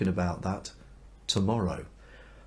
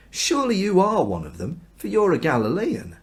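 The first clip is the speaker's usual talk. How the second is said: accusing; 9 semitones higher, 5 dB louder than usual. Acted. Bystanders accuse Peter.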